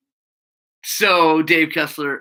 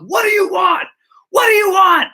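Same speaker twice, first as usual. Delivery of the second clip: high, loud mock yell; angry shout; 6 dB louder than usual. Mechanic confronting the returning customer, angry mock shouting. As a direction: replay a furious challenge, louder the second time. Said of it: "I'm so angry".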